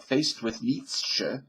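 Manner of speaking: "Nietzsche" said slowly as NEE-chuh; slow demo of "Nietzsche" with final schwa; correct pronunciation. Shown as a demonstration. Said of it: "that's the correct pronunciation".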